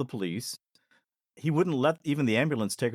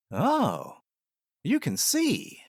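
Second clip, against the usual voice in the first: mock knowing tease; 11 semitones higher, much slower than usual. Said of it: "she kind of winks and she says".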